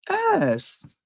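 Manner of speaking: very high drawn S; squeaky name call; high pet call. Real, not acted. Calling to the cat.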